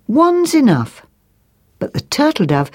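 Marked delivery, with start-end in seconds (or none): 0.1-1.0 high, firm correction; a busy bird putting her foot down, pitched up; magpie's retort. Acted. Magpie putting a twig across, high firm retort. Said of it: "So she said".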